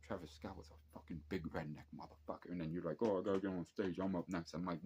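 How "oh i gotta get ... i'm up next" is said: loud, insistent demand to get on stage; pushy performer. Retold or imitated. Rapper pushing the security guard to let him on stage, loud and insistent. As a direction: shout it like you're late for your set. [name] demanding to go onstage.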